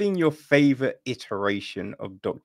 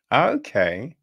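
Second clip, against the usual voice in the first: normal talking voice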